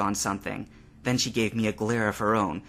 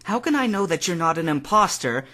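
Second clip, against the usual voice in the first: higher, louder worried question; Misty's voice; 8 semitones higher, 4 dB louder than usual. A narrator's reading. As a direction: press him, frightened and suspicious. Misty fearing impostor.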